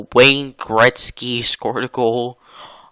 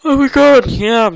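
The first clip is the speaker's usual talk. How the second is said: high, loud yawning exclamation; 14 semitones higher, 8 dB louder than usual. Real, not acted. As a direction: let it out through a yawn. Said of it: "Sorry for yawning".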